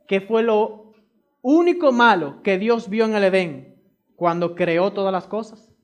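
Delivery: ordinary talk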